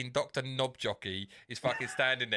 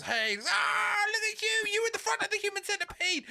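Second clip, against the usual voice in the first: much higher excited shout; another bloke. Retold or imitated. Retelling the sketch, the excited bloke shouts a high greeting. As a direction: greet him like a thrilled fan. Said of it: "another bloke turns up and goes".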